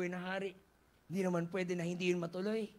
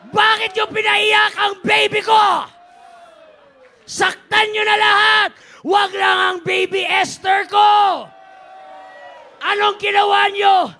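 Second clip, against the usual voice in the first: raging king, much higher and louder; furious shout; 20 dB louder than usual. Acted. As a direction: bellow it like a jealous, raging king. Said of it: "Sumigaw siya". (galit na galit na sigaw ng hari)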